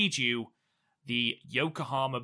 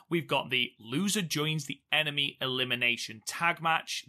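ordinary talk, nothing marked

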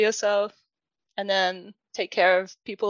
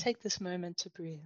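hushed, soft meditation-guide hush; mock meditation voice; 12 dB quieter than usual. Joking to her guest, a much softer mock meditation guide. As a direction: breathe it out like a podcast guide. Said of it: "This is [name]'s meditation podcast".

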